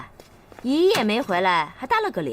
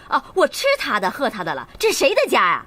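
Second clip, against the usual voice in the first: raised, indignant retort; indignant scolding; 2 semitones higher than usual. Acted. Mother asks whose house this is, indignant and raised. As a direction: throw the questions back angrily.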